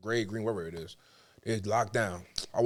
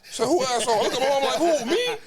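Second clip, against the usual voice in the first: much higher, louder, laughing disbelief; shocked disbelief; 12 semitones higher, 6 dB louder than usual. Retold or imitated. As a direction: squeak out the outrage. Shocked at prostate check.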